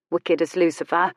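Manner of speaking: plain reading voice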